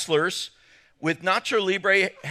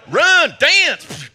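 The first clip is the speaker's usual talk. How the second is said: excited shout; 9 semitones higher, 11 dB louder than usual. Acted. Urging crowd to have fun.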